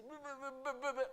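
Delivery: mocking sheep bleat; mock objection bleating. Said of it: "Y usted podrá decir".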